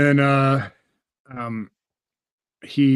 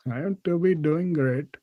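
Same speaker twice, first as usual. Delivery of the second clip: slow, lightly higher Indian English reply; mock Indian accent; 5 semitones higher than usual. [name] answering the boss, mock Indian accent. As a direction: parody the boss's accent back at him.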